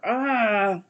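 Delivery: loud wordless cry of frustration; frustrated screech. A narrator's reading. Girl who cannot tell Dad what she wants, screeching. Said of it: "I screeched".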